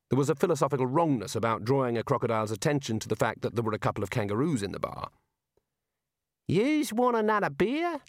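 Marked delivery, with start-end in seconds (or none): 6.5-8.0 high, slow Aussie barman; Dongo's Aussie voice. Acted. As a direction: drawl it like an outback barman. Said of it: "said Dongo".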